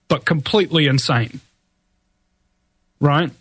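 normal talking voice

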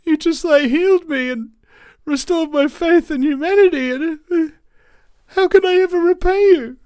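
high, loud overwhelmed thanks; tearful gratitude. Acted. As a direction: gush thanks through tears. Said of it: "the cell's like".

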